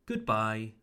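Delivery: "goodbye" with short vowel in good. Shown as a demonstration. Teacher models the last greeting, short oo in "goodbye". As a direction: say "goodbye" with a quick English "good". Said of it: "make it shorter".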